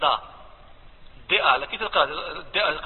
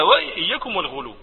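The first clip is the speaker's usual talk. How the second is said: ordinary delivery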